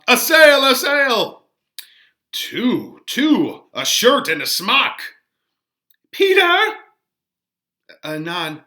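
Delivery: lively stage reading of the nurse's entrance; dramatic play reading. Acted. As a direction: act out the nurse, Peter and the young men. Teacher reads next scene aloud.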